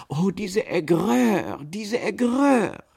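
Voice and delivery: loud, high, drawn-out wail; exasperated lament. A narrator's reading. Aunt fanning herself after humiliation, wailing exasperatedly. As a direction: wail it slowly, rising on the repeat.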